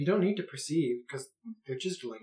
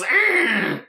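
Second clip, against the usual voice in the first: loud high creature yelp; pained yelp; 8 semitones higher, 10 dB louder than usual. Acted. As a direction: cry out like a stabbed mud creature. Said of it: "and it goes".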